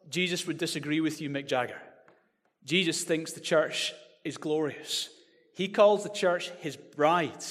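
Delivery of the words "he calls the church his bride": usual speaking voice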